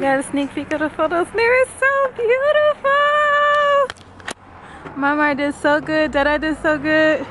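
high, loud squeal of joy; excited. Real, not acted. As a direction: gush with delight. Thrilled about the photos.

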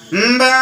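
imitated drawn-out moo; cow mooing. Cow breaking the holy man's meditation, imitated moo. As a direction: bellow a nasal "mbaa" like a cow. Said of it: "गाय केवढ्याने ओरडायला लागली".

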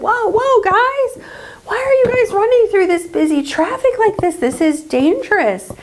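a startled officer stopping running kids, voiced high; traffic officer. Officer halting the kids in busy traffic, high put-on voice.

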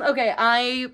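ordinary delivery

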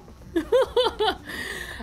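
high, hooting burst of panic; panicked, rapid high hoots; panicked noise. Real, not acted. Streamer startled by the game, high panicked hooting. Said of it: "if that was a panicked".

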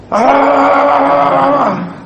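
long, high yelled "aaah"; mock battle scream. Husband opening fire in a marital argument, mock battle scream. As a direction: hold out a high, loud mock war cry. Mimics launching an attack in argument.

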